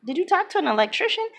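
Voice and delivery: high, quick, doubtful question; skeptical wife. Retold or imitated. Wife to husband about his battery scheme, retold skeptical question.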